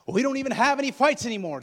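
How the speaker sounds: loud, high, quick protest; defensive spouse. Acted. Congregant objecting, acted quote.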